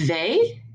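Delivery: "they" said "vay"; French V as "vé"; French letter V. Shown as a demonstration. Teacher showing French V to class, said "vay". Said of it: "Our V is gonna be".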